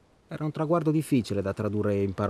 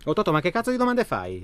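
higher and sharp, exasperated; irritated outburst; 6 semitones higher, 4 dB louder than usual. Acted. Annoyed friend snapping at Totò's question about children. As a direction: snap back at a nosy question.

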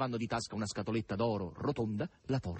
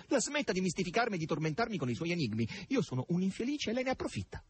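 high, rushed bitter protest; 9 semitones higher, faster than usual. A narrator's reading. Margherita accuses Azasello of tormenting her, bitter. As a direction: rush the reproach, pitch climbing. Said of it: "disse con un sorriso forzato e amaro".

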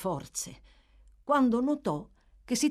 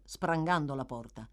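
plain reading voice

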